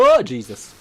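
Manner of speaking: sudden scared yelp, loud and high; startled yelp. Real, not acted. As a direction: jump at the fright and yelp. Jump scare in game.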